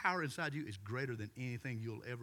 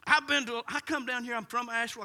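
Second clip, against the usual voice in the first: high, loud, pleading explanation; distraught woman; 9 semitones higher, 7 dB louder, faster than usual. Retold or imitated. Woman explaining her visits to the pastor, high. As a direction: pour out the explanation in raised pitch.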